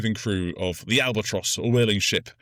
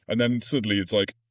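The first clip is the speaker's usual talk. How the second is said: normal talking voice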